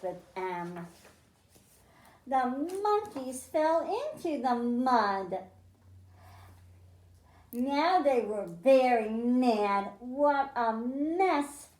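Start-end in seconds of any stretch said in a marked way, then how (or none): none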